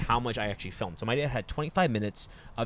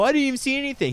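loud, high-pitched retold protest; mock protest; 5 dB louder, faster than usual. Son retells answering joking dad, mock protest. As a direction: raise the pitch right up in mock complaint.

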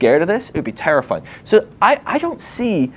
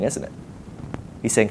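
ordinary talk

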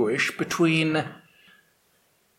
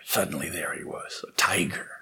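hushed; 9 semitones lower, 7 dB quieter than usual. A narrator's reading. Narrating the magical transformation.

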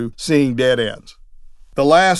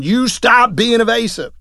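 imitating a yelling judge; 8 semitones higher, 3 dB louder than usual. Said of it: "this judge is yelling at him".

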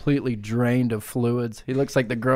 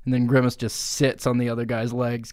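usual speaking voice

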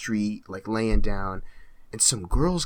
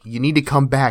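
much louder stern police command; cop's order; 3 semitones higher, 10 dB louder than usual. Retold or imitated. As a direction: order him back like a cop. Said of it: "And the cop's like".

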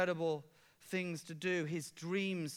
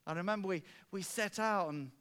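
normal talking voice

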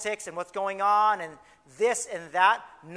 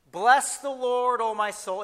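slow, lifted, louder opening of the psalm; solemn scripture reading; 5 semitones higher, 5 dB louder, slower than usual. Shown as a demonstration. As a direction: proclaim it slowly like King James verse. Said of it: "People don't talk like this anymore".

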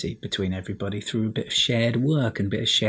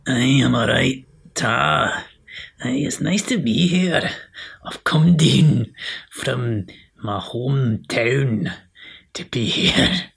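hammed-up Scottish accent, louder; 2 semitones higher, 4 dB louder, slower than usual. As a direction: put on a broad Scottish accent. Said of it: "I'm Scottish, Chris".

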